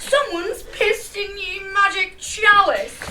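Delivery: loud, hammed-up old-timey speech; mock archaic voice. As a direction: parody a theatrical medieval maiden. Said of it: "everything's like".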